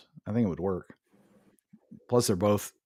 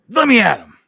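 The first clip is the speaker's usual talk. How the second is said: loud, high mock rage; mock fighting cry; 12 semitones higher, 11 dB louder than usual. Mock threat to fight Drew McIntyre, loud and high. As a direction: pretend to lunge at him.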